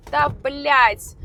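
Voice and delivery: loud, high Russian swear; exasperated curse. Real, not acted. Fed-up blogger swearing at the road, loud. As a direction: blurt it out in frustration.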